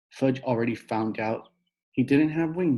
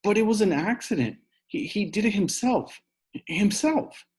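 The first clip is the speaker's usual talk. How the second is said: crying louder; 9 semitones higher than usual. Acted. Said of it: "Sheila cried louder".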